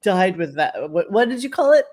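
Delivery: ordinary talk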